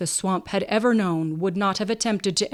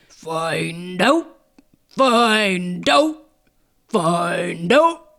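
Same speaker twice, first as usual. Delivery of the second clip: bullfrog call, louder, rolled like a drum; imperative drumbeat; 5 dB louder, much slower than usual. A narrator's reading. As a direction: drum it out as a commanding frog. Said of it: "as an imperative drumbeat, rolled it again".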